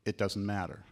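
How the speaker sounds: ordinary talk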